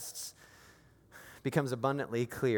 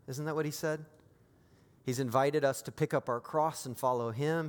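ordinary delivery

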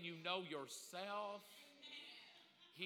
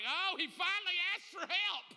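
mock exasperated onlookers; 10 semitones higher, 7 dB louder than usual. Voicing heavenly onlookers.

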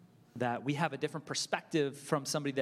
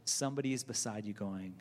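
ordinary delivery

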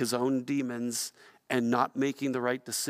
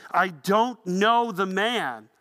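slow, louder and higher, each word stressed; emphatic sworn denial; 5 semitones higher, 5 dB louder, much slower than usual. Acted. Peter swearing to his accusers, slow forceful denial. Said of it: "began to call down curses, and he swore".

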